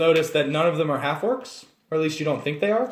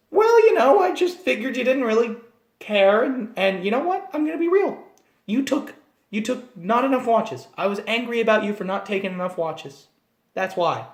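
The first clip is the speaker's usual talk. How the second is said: high, chirpy little gnome confessing; annoying gnome voice; 6 semitones higher than usual. Shown as a demonstration. Gnome player confessing a hidden note to the party, squeaky. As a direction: keep the squeaky voice going, oblivious. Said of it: "hearing me talk like this".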